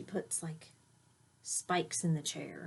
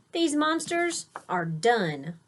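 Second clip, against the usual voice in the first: firm decision; 5 semitones higher, 6 dB louder, slower than usual. Acted. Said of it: "she finally decided".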